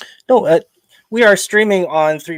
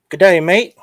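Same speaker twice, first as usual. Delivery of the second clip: put-on botched Australian accent, loud and higher; raised, punchy mock-Aussie lilt; bad Australian accent; 5 semitones higher, 8 dB louder than usual. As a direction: attempt a hammy Australian greeting and miss. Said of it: "probably the worst Australian accent ever".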